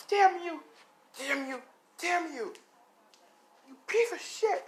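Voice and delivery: very high, shrieked cursing; acted hysterical rage, pitch way up; furious screaming. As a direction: scream curses while lashing out. Woman beats him in anger.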